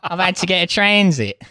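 high, badly faked British-Australian accent; imitated fake British voice. Copying co-host's British voice, badly faked accent. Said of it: "I did your British voice".

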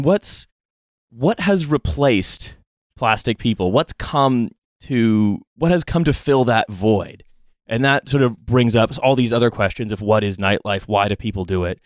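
ordinary delivery

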